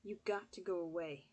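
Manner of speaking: flat, slurred, sedated monotone. Acted. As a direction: drag it out like you're heavily sedated. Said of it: "Her voice was monotone, depressed, and slurred".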